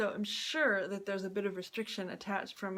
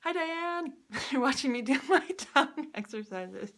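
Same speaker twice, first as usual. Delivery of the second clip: laughing through the words, a bit louder; 6 semitones higher, 4 dB louder than usual. Real, not acted. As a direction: giggle as you speak to your friend. Friend watches, she laughs.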